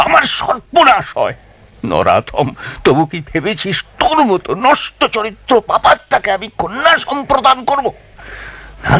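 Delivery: righteous rage, pitch climbing on the insults; father refusing the villain in a fury; furious denunciation. Acted. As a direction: hurl the curses with mounting fury.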